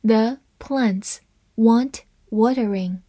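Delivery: slow, even read, every word clear. Shown as a demonstration. Teacher reading the example sentence slowly for learners. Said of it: "我们分别来慢读一遍".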